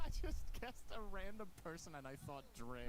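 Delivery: slow, high, laughing through it; teasing repeat while cracking up. Real, not acted. Repeats partner's line laughing.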